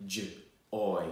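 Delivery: J-oy segmented slowly into J and oy; sounding out words. Shown as a demonstration. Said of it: "for me to sound out some words".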